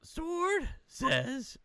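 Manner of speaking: high squeaky sword voice; magic sword voice. Acted. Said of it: "I gotta get the right voice".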